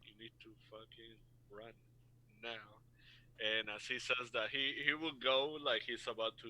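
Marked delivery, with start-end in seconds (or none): 0.0-2.8 very soft urgent whisper. Acted. The player character whispering back to Jonathan, very soft. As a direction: breathe it so the thugs can't hear. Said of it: "whisper back".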